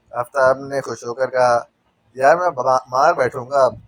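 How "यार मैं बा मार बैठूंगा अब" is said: mild mock shout. A narrator's reading. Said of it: "inspector Kamran Mirza ने चिल्ला कर कहा".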